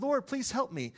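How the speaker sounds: high-pitched retold plea; mock plea. Stranded woman praying for help pushing her car, high imitated plea.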